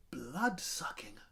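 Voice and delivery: soft, slow unvoiced whisper; awed whisper. A narrator's reading. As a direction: breathe it in fascination.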